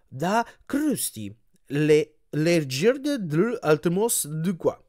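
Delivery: very slow, stumbling sign-reading; laboured, faltering decoding; halting reading. A narrator's reading. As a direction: puzzle out garbled words bit by bit. Struggling to read a garbled sign.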